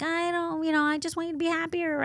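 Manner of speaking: put-on shy small boy wishing for mom; mock kid voice. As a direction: son telling mom his birthday wish, lightly voiced. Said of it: "he's like".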